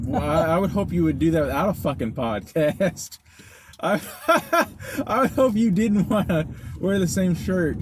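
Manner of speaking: high-pitched, laughing through the words. Real, not acted. Co-host teases friend's same-shirt rule, laughing.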